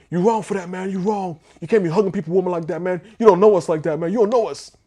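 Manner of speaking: high, loud, worked-up mock protest; real emotional, imitated. Groom chasing him round the car, imitated as emotional and high-pitched. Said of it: "He's getting real emotional".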